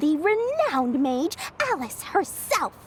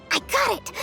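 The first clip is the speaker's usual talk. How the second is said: quick, higher burst of excitement; sudden inspiration; 6 semitones higher, faster than usual. Acted. Seller hits on a price for the kids, excited. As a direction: blurt out the bright idea, fast and high.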